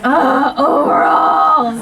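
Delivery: slow, high, drawn-out taunt; singled-out mock disgust, stretched high; mocking kid's jeer. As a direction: imitate a snotty kid making fun of an outfit. Mocking kids' reaction to overalls.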